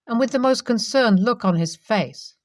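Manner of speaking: ordinary delivery, nothing marked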